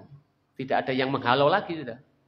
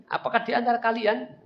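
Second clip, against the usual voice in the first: shouted taunt; 8 semitones higher than usual. Acted. Abu Sufyan jeering at the Muslims on the hill, shouted taunt. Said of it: "Lalu dia teriak lagi".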